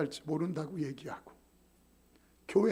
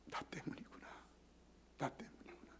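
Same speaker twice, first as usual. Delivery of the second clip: broken whisper; 2 semitones lower, 18 dB quieter than usual. Retold or imitated. Weeping after God's rebuke.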